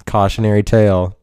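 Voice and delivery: normal talking voice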